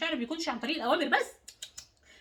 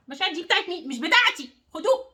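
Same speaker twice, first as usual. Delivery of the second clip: demo of handing back; 5 semitones higher, 4 dB louder than usual. Shown as a demonstration. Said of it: "عايزاكي ترجعي لكل واحد مشاعره".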